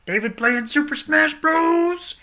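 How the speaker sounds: high, slow excited squeal. Real, not acted. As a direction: squeal it out in delight.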